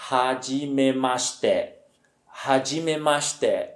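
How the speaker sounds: slow "hajimemashite", every syllable drawn out; careful, even teacher's "hajimemashite"; correct pronunciation. Shown as a demonstration. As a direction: model "hajimemashite" slowly for learners. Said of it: "a pronúncia correta da expressão toda é".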